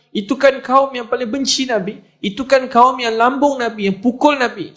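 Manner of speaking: agitated amazement. Acted. Abu Sufyan watching former enemies march into Mekah, high agitated amazement. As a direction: pitch it up and hammer out each clause.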